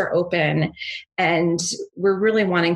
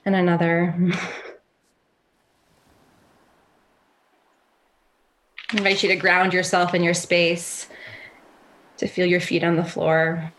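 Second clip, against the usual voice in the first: calm grounding exercise. Acted. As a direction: gently guide them to settle. Leads a grounding meditation.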